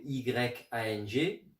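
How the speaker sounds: Y A N G spelled letter by letter, slowly; slow letter-by-letter spelling. Shown as a demonstration.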